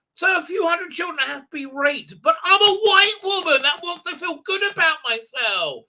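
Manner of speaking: high, hammed-up mock yell; mock shrieking. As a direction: cry it out in exaggerated self-righteousness.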